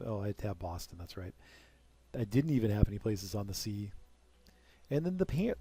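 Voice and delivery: usual speaking voice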